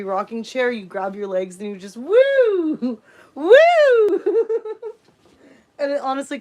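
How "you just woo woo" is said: high loud woo breaking into laughter; joyful whoop. Shown as a demonstration. Demonstrating the free rocking chair, laughing whoop. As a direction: whoop while rocking with a toy. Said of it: "just do this".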